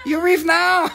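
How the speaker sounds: put-on very high, loud accented order; comedy-routine impression. Restaurant host throwing out a big eater, high mock scolding. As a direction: throw them out in a high comic voice. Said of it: "This is from a nineteen ninety something".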